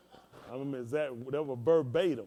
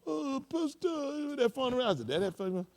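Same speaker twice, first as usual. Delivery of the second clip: high, drawn-out mock "Oh, Pastor"; imitating Kim; 13 semitones higher, slower than usual. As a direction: stretch it out sweetly like an eager church member. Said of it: "Kim be going".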